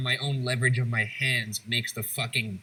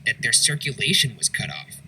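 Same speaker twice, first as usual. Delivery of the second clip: firm, raised, scripted voice-over; documentary narration; 5 dB louder than usual. A narrator's reading. Host telling viewers of the mad chair, documentary narration. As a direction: narrate grim facts with punched emphasis.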